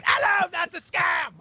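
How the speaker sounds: very high falsetto British-style scream; hammed-up screeching warning, shouted high; Russell Brand screaming. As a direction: scream it in a frantic mock celebrity voice. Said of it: "Russell Brand also screaming at you".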